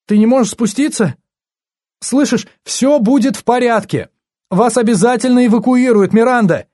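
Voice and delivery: high, frantic cries to a friend; panicked, high-pitched phone shouting. A narrator's reading. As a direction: call out desperately, pitch way up. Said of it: "кричала в трубку побледневшая Энни".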